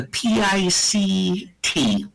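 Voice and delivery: letters spelled out slowly. Shown as a demonstration. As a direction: spell P I C T one by one. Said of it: "spelling out a word".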